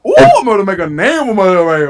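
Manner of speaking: playful fast Louisiana accent impression; Louisiana accent attempt. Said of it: "I can't do the Louisiana".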